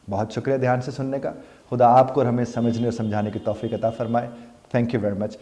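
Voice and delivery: ordinary delivery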